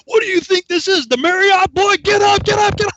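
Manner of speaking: high, loud mock berating; drill sergeant yelling. Sergeant looms over dazed recruit, mock yelling. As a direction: bellow it high like an outraged sergeant.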